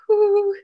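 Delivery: high, loud exhaled whoop; freaked-out whoo. Retold or imitated. Retelling leaving the elevator freaked out, high whoo. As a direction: let out a high whoo, re-enacting nerves. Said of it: "I'm, like, freaked out".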